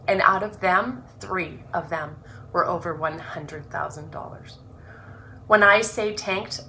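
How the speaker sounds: earnest, measured reading of her own introduction; reading her book aloud. Acted. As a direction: read it like a heartfelt opening chapter.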